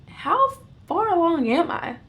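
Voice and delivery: high-pitched, disbelieving question; acted-out dawning alarm; stunned question. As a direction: ask it as the shock lands. Retold shock at advanced pregnancy.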